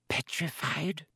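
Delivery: soft hushed question; whisper. A narrator's reading. Pomfrey asking over Colin, whispered. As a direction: barely voice it. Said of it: "whispered Madame Pomfrey".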